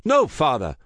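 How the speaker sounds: loud, high pleading wail. A narrator's reading. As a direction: cry out in despair. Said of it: "wailed Juan Gonzalez".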